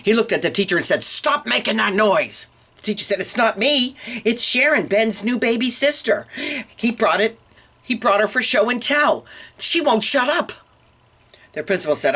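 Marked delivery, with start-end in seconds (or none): 1.0-2.5 raised pitch, slow emphatic order; scolding principal. Acted. Principal scolds the noisy teacher. 3.2-10.5 high-pitched defensive teacher; flustered protest. Acted. Flustered teacher blames the baby.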